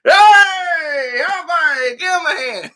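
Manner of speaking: hammed-up movie shout; mock shout. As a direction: yell it like the cop in the scene. Quoting movie line, shouting.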